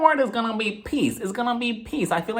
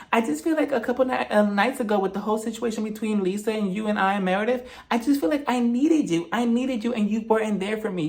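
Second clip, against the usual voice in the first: mock whiny Whitney; 3 semitones higher, 3 dB quieter than usual. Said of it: "she went on to say".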